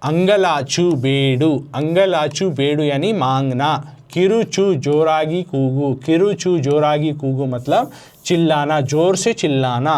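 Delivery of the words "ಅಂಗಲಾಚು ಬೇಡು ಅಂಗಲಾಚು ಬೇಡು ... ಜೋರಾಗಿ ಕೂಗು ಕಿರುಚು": slow, careful reading of ಅಂಗಲಾಚು ಬೇಡು; teacher rereading the list slowly for students; slow dictation. Shown as a demonstration. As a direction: spell it out slowly for the class. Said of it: "again I am going to read this".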